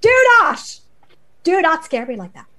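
loud, high scolding that settles down; stern motherly lecture. Acted. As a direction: scold him like a frightened mother. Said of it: "a very stern motherly lecture".

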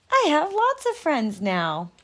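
bright, cheerful announcement; happy. Acted. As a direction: tell it with glee. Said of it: "he said it again very loudly".

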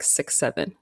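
ordinary talk

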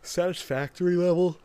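a burp in the words. Real, not acted. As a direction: talk through a burp. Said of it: "I'm burping".

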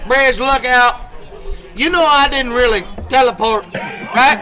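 lookout roept luid en hoog door de spreekbuis, onzeker; calling out. Retold or imitated. Seaman calls down the tube.

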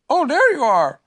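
high-pitched, calling out; Pooh. A narrator's reading. Pooh finds the caller outside, high loud voice. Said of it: "said Pooh".

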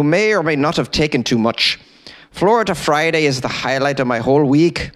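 self-admitted bad Irish accent. Shown as a demonstration. Host reading Chris's note in a rough Irish accent. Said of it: "I don't do a great Irish accent, but here it is".

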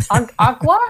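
put-on halting German accent, "vata" with a v. Storyteller mimics the guitarist asking for a drink, halting German accent. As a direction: imitate a German struggling to order "vata". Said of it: "I had to teach [name] how to say water".

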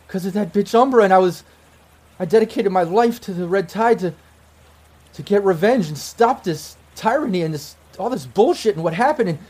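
high, impassioned, breaking into restarts; crying in disbelief. Acted. Ex-girlfriend explaining her revenge to lost love, in acted high distress. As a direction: pour out the whole story, overwrought. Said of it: "And she's just crying".

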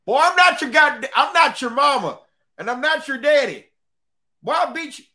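loud, high-pitched drill-sergeant tirade; acted angry scolding. Bored veteran guard threatens a student, scolding loudly. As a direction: tear into a kid at full voice.

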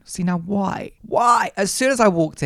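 high indignant why. Real, not acted. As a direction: demand it in disbelief. Indignant rhetorical question.

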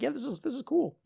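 high, light mock enthusiasm; mock enthused. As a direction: chirp it up high. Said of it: "It's just like".

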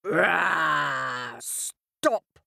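growling roar then a sharp stop; lion roar. A narrator's reading. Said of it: "The lion roared again".

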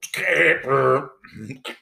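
imitated high electronic wheeze and sputter; mock computer death sound; dying computer noise. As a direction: mimic a computer sputtering as it dies. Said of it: "that's the sound the computer made when it died".

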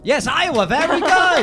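high, loud burst of praise; thrilled quizmaster confirming a winner; delighted congratulation. Real, not acted. Host cheers a correct answer.

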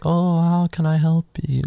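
high-pitched sweet old lady impression. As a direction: put on a little old lady's high voice. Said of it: "the old lady's like".

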